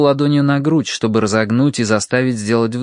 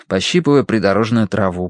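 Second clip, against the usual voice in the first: ordinary delivery, nothing marked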